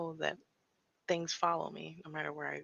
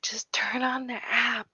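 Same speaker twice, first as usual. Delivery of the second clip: slow, higher, loud mock whisper; impatient viewer; 6 semitones higher, 9 dB louder, slower than usual. Imitating an impatient viewer telling her to start, mock whisper. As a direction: play the bored viewer urging her on. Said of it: "whoever's watching is like".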